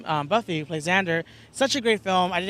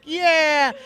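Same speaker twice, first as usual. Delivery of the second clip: triumphant yeah; 9 semitones higher, 7 dB louder than usual. Retold or imitated. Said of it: "I'm like".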